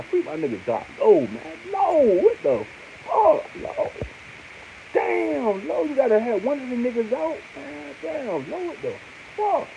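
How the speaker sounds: high pained whine at a friend; anguished high cry. Real, not acted. Fan lamenting Lowe's arrest, high anguished whine. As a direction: cry out his name in disbelief.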